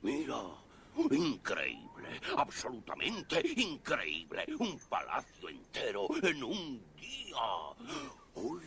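high, excited marvelling; amazed. Acted. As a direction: gush over the palace. Troll marvels at palace.

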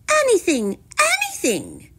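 high plea that drops on the repeat; eager princess. A narrator's reading. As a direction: agree hastily, high then easing down.

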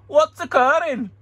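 a hammed-up Welsh accent, pitched high; bad Welsh accent. Seller mocking himself in a bad Welsh accent. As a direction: throw on a terrible Welsh accent for a laugh. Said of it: "That was the worst Welsh accent ever".

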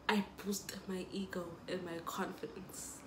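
whispered confession, half-joking; whispered aside. Real, not acted. Telling viewers about her drinking, a sly whisper. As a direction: let it slip out in a whisper.

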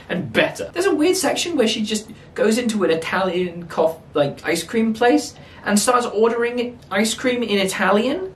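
high-pitched, exasperated protest; incredulous rant. Real, not acted. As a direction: rant as if nothing makes sense. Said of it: "that was a pretty long rant".

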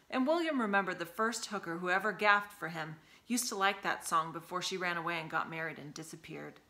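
ordinary delivery, nothing marked

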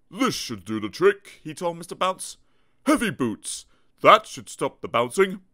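doctor voice. Acted. Doctor character voice.

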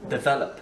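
"develop" with VEL stressed. Shown as a demonstration. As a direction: hit the middle syllable of "develop". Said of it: "on accentue le V". (accent tonique sur « vel » dans « develop »)

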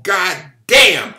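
explosive, high shouted oath; loud curse. A narrator's reading. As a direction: yell it out as the blow misses. Swung skillet misses the rat.

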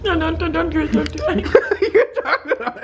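pleading through laughter; giggling no, don't do it; laughing protest. Real, not acted.

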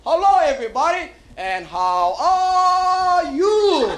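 drawn-out high greeting, stretched slow; official Kansas City greeting. Pretend. Comic escort greets the crowd in a high, stretched-out call. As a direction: sing it out like a chipper tour escort. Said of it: "the official Kansas City greeting".

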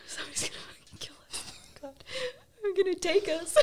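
fast frightened whisper; terrified whisper. Retold or imitated. To his friend in the dark room, a terrified whisper. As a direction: hiss it in hushed terror.

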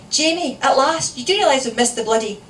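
high, loud scolding that speeds up; exasperated wife. A narrator's reading. Wife greeting late husband at door, exasperated. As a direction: burst out crossly, then rattle on.

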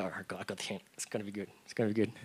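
a hint of a tired mother muttering under her breath; weary mom. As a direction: play the mom sighing she got the hint. Mom voiced in a story.

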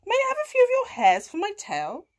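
high, loud, quick request; cat asking. A narrator's reading. Cat asking cow for tail hairs, high cat voice. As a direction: ask eagerly as the cat. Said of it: "said Kat".

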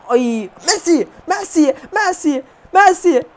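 drawn-out, rising calls of Messi; excited drawn-out Messi chant; calling out to Messi. Real, not acted.

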